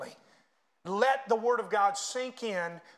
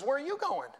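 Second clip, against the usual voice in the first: questioning father; 8 semitones higher than usual. Retold or imitated. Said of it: "And he says".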